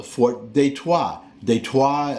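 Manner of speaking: Detroit said as day-twah; French pronunciation. Shown as a demonstration. Giving the fort's original French name, Detroit as day-twah. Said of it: "it's a French name".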